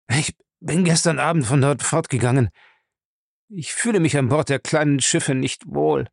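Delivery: subdued man giving in under questioning; cowed captive. Acted. Lacey answering interrogation.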